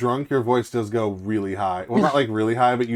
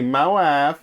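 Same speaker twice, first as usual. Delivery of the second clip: put-on light Borat accent, a bit louder; 3 semitones higher, 4 dB louder than usual. Friend urging a regular joke, light Borat accent. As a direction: toss off the Borat line. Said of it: "Just be".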